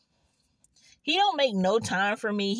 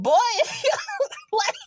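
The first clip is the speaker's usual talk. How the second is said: high, louder laughing start of a comeback; laughing retort; 6 dB louder, faster than usual. Retold or imitated. Retelling her comeback to the boy, laughing. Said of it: "I'm like".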